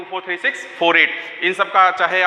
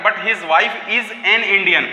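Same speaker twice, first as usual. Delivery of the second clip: very slow reading with "an" pressed before "Indian"; teacher dictates, marking "an"; stress on an; much slower than usual. Shown as a demonstration. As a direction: slowly land on "an" in "is an Indian". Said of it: "Indian से पहले एन आएगा".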